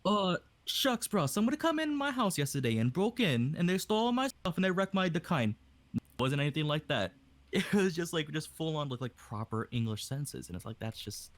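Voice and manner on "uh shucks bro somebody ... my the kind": higher-pitched, casual Hawaiian Pidgin; Hawaiian Pidgin accent. Shown as a demonstration. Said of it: "do it in a Pidgin accent".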